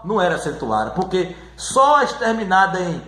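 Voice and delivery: ordinary talk, nothing marked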